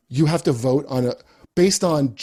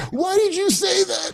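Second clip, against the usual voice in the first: mock crying. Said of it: "And he's, like, crying".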